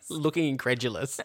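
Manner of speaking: ordinary talk, nothing marked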